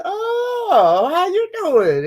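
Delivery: high, loud sing-song greeting; flirty greeting. Retold or imitated. Greeting the handsome man, high and loud. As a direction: sing it up high to catch his eye. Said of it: "looked at him, I said".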